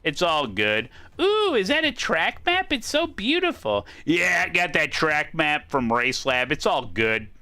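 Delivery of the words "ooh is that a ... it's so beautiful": put-on high, breathy-free admiring girlfriend. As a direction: gush over it like a girlfriend. Sponsor skit, girlfriend voice.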